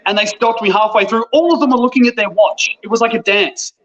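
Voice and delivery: usual speaking voice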